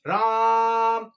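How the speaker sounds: राम shouted loud and drawn out long; long loud far-off call of राम; calling from afar. Shown as a demonstration. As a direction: call राम to someone far away, stretching the vowel. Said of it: "आप ऐसे चिल्लाना ही पड़ेगा दूर से".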